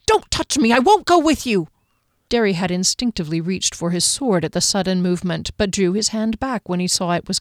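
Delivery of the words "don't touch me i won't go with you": high, loud terrified scream. A narrator's reading. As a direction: scream her off in panic. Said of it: "she screamed".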